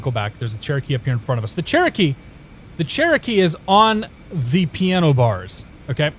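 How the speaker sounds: ordinary talk, nothing marked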